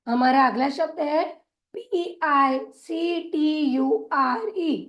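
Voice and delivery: normal talking voice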